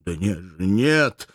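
anguished denial, rising on the last word; pleading, distressed exclamation; nearly crying. A narrator's reading. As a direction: protest as if close to tears. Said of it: "воскликнул почти плача бедный германец".

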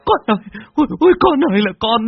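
loud, very high-pitched frantic wail; wailing shriek. A narrator's reading. Mother runs to her fallen son, wailing and shrieking. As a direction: scream out a mother's grief, scolding and lamenting. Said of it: "Tiếng cô Khai từ trong ngõ rú lên".

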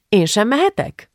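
high, pleading question; hopeful asking. A narrator's reading. Gitta asks father to let her go, hopeful. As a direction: ask with a selfish little hope. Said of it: "kérdezte Gitta reménykedve".

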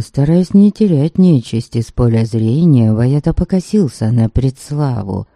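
usual speaking voice

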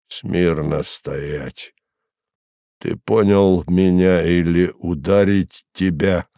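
low, slow centurion laying down rules; nasal, poorly articulated command. A narrator's reading. Centurion instructing the fallen prisoner, nasal and slow. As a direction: order it slowly through the nose, low. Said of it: "гнусаво, плохо выговаривая арамейские слова".

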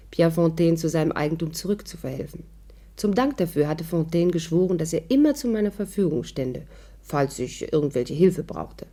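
steady storytelling voice recounting the evening; story narration. A narrator's reading. Celia narrating to the listeners, in a storytelling voice. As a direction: tell it like a radio narrator looking back.

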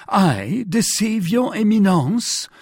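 slow, high-pitched incredulous question; crying out in protest. A narrator's reading. Prisoner answering the Cardinal's accusation, crying out in protest. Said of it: "cried Bonacieux".

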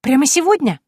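very high gleeful gasp; little girl thrilled by the promise of lessons; delighted child. A narrator's reading. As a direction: squeal with joy like a small girl.

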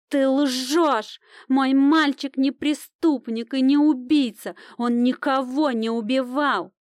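high, drawn-out anguished denial; a mother desperately defending her boy; outraged protest. A narrator's reading. Mother protests her son's innocence.

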